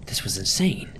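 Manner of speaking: hushed, breathless whisper to herself; panicked whisper. A narrator's reading. Alone on the empty road, a panicked whisper. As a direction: breathe it out in disbelief.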